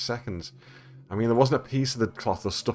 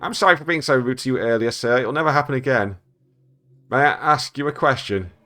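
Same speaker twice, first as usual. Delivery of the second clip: meek, slightly raised parrot voice; sincere parrot; 4 semitones higher, 3 dB louder than usual. Acted. Parrot apologising to its owner, mildly pitched and sincere. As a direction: apologise politely as a chastened parrot. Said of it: "says sincerely".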